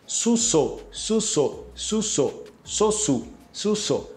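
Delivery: soft repeated su then so, vowel u then o; su versus so. Shown as a demonstration. Teacher drilling su and so for learners. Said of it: "Um é su e o outro é".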